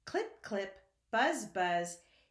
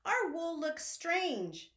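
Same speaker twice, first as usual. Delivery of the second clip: high, slow sheep complaint; high slow sheep grumble; puzzled sheep voice; 8 semitones higher than usual. Acted. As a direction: say it like a baffled sheep. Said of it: "they cried".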